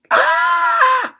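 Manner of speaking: piercing high release scream; screaming into pillow. Real, not acted. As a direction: scream it all out. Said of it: "Scream into a pillow if you have to".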